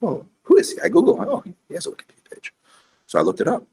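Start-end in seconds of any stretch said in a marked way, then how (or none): 0.0-2.5 much higher, louder excited wondering; eager curiosity. Retold or imitated. Retelling how he googled the lawyer, high and eager.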